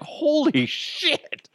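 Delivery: very high, drawn-out disbelief; re-enacted floored amazement; astonished. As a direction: stretch it into a squeaky exclamation. Retelling his astonishment.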